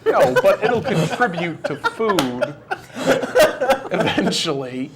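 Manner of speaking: slow, laughing through the words; can barely get it out laughing. Real, not acted.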